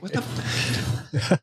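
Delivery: whispered cut-off curse at the sight; shocked whisper. Retold or imitated. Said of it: "I'm like".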